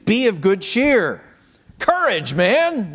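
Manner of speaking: high, encouraging call; cheering him on. Retold or imitated. Crowd now calling the blind man to Jesus, heartening. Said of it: "Bartimaeus hears a different voice".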